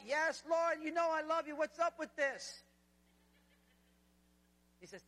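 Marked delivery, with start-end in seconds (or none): 0.1-2.6 higher, insistent answer with drawn-out protest at the end; worked-up, pleading comeback; exasperated Peter. Acted. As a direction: protest at being asked yet again. Peter exasperated at being asked again.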